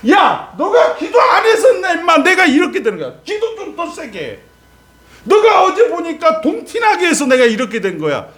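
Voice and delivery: high, loud, half-laughing accusation; mock blaming shout. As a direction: yell the blame at the other person, high and loud. Voices believer blaming others.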